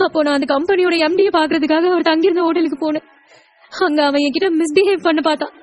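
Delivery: high, tearful voice; tearful account. Acted. Wife confiding the harassment to her husband, tearful. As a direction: recount it as if close to crying.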